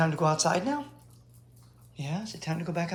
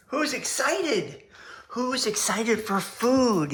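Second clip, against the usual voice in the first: excited pet talk; 5 semitones higher, 4 dB louder, much slower than usual. Acted. Hyping dogs for dinner.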